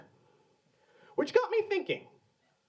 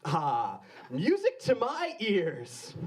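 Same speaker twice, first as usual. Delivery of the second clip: mock savoring; 4 semitones higher, slower than usual. Savoring phrase, slow high voice.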